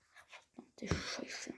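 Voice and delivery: whispered curse; cursing under breath. Real, not acted. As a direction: hiss the swear under your breath. Game frozen, swearing.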